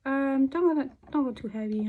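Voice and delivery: normal talking voice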